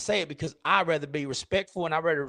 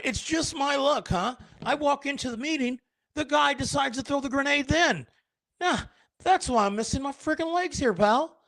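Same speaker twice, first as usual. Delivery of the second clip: much higher, whiny hard-luck voice; put-on unlucky guy; 12 semitones higher than usual. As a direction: complain like a sorry soul who lost his legs.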